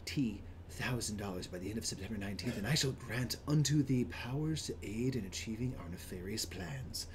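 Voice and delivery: theatrical, ominous read that sinks away; dramatic villain narration. Acted. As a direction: intone it like a dark master to followers. Reads villain's speech from game page.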